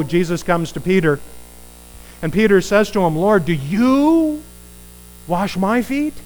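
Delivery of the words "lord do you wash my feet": slow, higher, stunned question; incredulous. Acted. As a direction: ask it in disbelief. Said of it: "He's incredulous".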